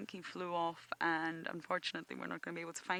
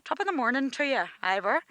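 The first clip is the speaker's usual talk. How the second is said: a cheery attempt at an Irish accent, a bit louder; 5 semitones higher, 9 dB louder than usual. Greeting Irish viewer Ivor in a playful Irish accent. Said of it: "I'm not very good at the Irish accent, but I'll give it a go".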